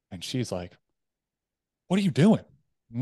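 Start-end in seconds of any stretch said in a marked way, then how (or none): 1.9-2.4 higher, louder imitated challenge; sharp challenge. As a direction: act out a partner demanding an answer. Acted quarrel, partner challenging him.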